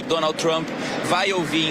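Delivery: ordinary delivery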